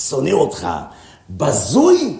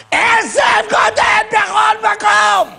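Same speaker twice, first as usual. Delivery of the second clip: high, slow shout; shouted proclamation; 6 semitones higher than usual. Acted. Preaching to listeners about grass, shouting. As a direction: shout it out, stretching the words. Shouts answer to own question.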